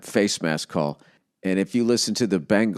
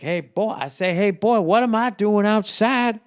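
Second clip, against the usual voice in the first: Foghorn Leghorn voice; 12 semitones higher than usual. Retold or imitated. Retelling Foghorn Leghorn calling the dog, high rooster impression. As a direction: ham up the blustering cartoon rooster. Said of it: "he's like".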